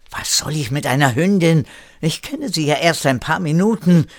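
lauter, gereizter Einwand; schimpfend. Acted. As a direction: schimpf empört los. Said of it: "schimpfte Erik". (Erik schimpft über die Hündin)

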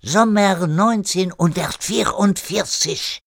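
put-on high, slow French-accented German. René giving Erik his goal, emphatic French accent. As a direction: intone the year slowly with a French accent.

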